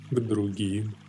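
usual speaking voice

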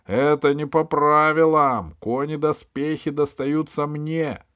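high, loud cry of objection; shouted protest. A narrator's reading. Pashka claims the horse and armour, shouting in protest. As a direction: call out in protest, pitched high. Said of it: "но Пашка закричал".

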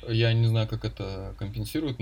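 ordinary talk, nothing marked

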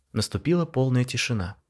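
ordinary delivery, nothing marked

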